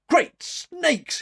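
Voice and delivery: sudden high blurt of surprise; startled exclamation. A narrator's reading. Cyrus blurts it out on learning her name, startled. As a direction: burst out, caught off guard. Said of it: "he ejaculated".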